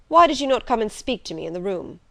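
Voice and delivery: quick, demanding master's question; brusque master. Acted. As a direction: press her, brusque and quick. Rochester questions Jane.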